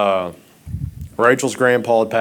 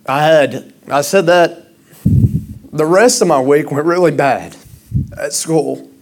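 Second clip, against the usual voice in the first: louder, higher, urgent sermon voice; impassioned preaching; 7 semitones higher, 4 dB louder than usual. Acted. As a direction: preach it hard to the congregation. Pastor preaches on absent fathers.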